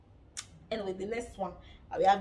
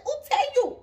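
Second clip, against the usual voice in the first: shouted comeback; 10 dB louder than usual. Retold or imitated. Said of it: "She said".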